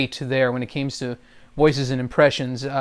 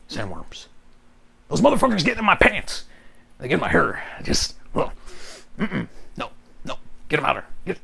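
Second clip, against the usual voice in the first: Beetlejuice. Acted. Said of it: "Beetlejuice kinda goes about just like".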